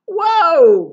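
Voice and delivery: loud, high drawn-out whoa; storybook cry of alarm; alarmed groan. A narrator's reading. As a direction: wail it as the branch gives way. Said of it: "groaned Tortoise and Bird".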